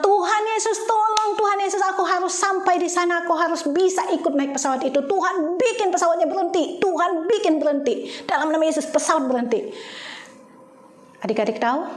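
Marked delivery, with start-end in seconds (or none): 0.0-9.7 high urgent begging to God; urgent prayer, high, easing off at the end; pleading prayer. Retold or imitated. Retold desperate prayer continues.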